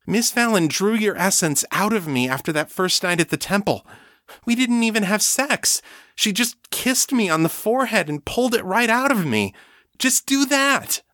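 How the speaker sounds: high, pressing, near-frantic pleading; a woman begging her partner, high and frantic; almost frantic. A narrator's reading. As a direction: rush it like she's losing control. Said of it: "speaking louder and faster now, almost frantic".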